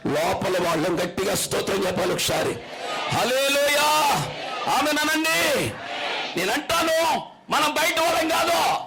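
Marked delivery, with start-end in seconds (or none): 3.1-8.8 rallying shout. Real, not acted. Preacher whipping up the congregation, shouting high. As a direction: lift the hall with it.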